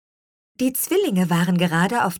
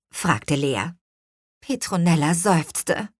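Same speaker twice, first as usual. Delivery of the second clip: ordinary delivery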